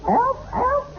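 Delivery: very high squeaky elf chirp; christmas elf. Acted. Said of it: "Prove it, [name]".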